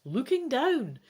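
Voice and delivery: ordinary delivery, nothing marked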